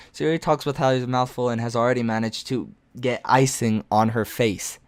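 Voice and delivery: usual speaking voice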